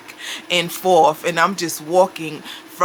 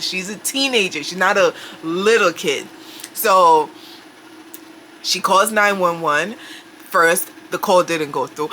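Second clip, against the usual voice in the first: ordinary talk, nothing marked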